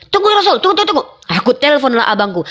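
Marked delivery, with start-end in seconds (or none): 0.1-1.0 eager mock plea.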